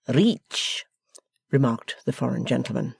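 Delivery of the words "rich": put-on French-accented reach. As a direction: say rich the French way. Foreigner mimicking the word.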